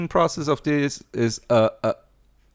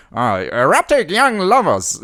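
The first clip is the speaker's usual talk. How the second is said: higher, hammed-up British accent; mock British accent; 5 semitones higher than usual. The British announcing their film title, hammed-up British accent. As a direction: put on a plummy British voice. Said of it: "the British really Brited it up".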